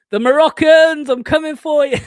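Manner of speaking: loud, high mock battle cry; jokey hollered warning. Streamer jokingly calling out Moroccan fans, hollered. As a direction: holler it high as a playful threat.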